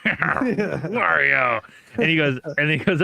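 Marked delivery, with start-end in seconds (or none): 0.0-1.6 drawn-out, louder Wario with a long fall; hammed-up Wario voice; Wario impression. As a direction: growl out the name like Wario. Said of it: "I can do a Wario".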